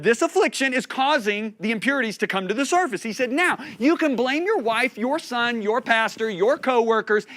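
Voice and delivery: full-force preaching. Retold or imitated. Preacher retelling the Lord's answer about blame, full-force preaching. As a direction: drive each item home forcefully.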